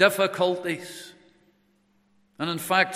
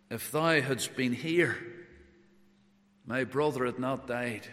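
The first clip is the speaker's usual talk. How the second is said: grieving plea; 5 semitones lower, 8 dB quieter than usual. Acted. Mary's words at Jesus' feet.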